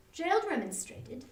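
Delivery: plain reading voice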